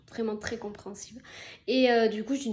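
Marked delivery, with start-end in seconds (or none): 0.1-1.2 whispered aside. Real, not acted. Whispered aside about the director.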